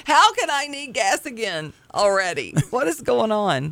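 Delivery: baffled complaint, lightly acted; mock exasperation. Mock exasperated self quote.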